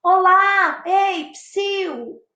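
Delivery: high hailing call. Shown as a demonstration. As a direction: call to get someone's attention.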